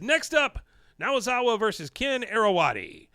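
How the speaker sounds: high, loud ref announcing the bout; announcer call. Retold or imitated. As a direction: call the next match out. Referee announces the next match.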